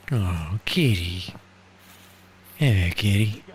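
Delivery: tender. Real, not acted. Greeting a cat in game.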